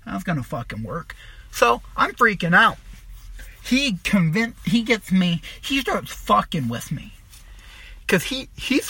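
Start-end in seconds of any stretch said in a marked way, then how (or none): none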